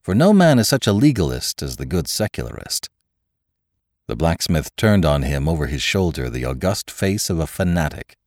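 plain reading voice

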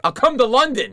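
high, loud, over-eager outburst; blurted out. Retold or imitated. Starstruck fan offering the star anything, loud high blurt. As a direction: blurt it far too eagerly. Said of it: "I blurted out".

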